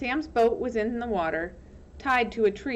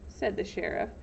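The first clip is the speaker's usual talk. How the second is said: ordinary delivery, nothing marked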